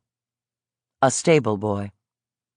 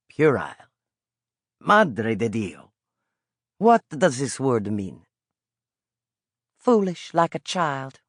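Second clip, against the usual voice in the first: put-on slower, puzzled old Italian voice; Italian old vendor, puzzled. Old vendor puzzled by the boy's big word, Italian accent. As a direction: turn the strange word over curiously.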